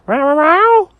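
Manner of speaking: high, loud cat meows; imitated meowing. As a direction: yowl like a chattering cat. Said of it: "all they heard was".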